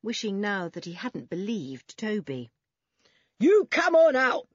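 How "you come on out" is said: very loud, high-pitched order; roaring. A narrator's reading. Man ordering children out of the pool, roaring. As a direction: roar it like a furious official. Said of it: "roared the man".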